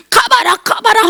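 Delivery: high-pitched imitation of noisy prayer; mock loud praying. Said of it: "큰 소리로".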